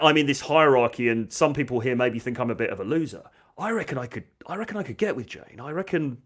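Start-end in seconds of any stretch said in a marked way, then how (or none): 3.6-6.2 hushed daydream aloud; private hopeful musing. Retold or imitated. Voices his hopeful teenage thoughts.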